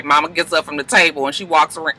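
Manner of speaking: usual speaking voice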